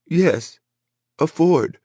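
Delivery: put-on very high, timid girl voice; frightened little girl voice. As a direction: answer like a scared child. Scared woman answers villain.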